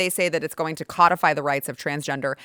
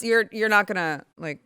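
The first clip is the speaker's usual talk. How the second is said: ordinary talk, nothing marked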